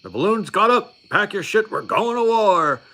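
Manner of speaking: hammed-up drill instructor shouting; mock drill instructor yell. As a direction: bark the war alert like a drill instructor. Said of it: "where they were like".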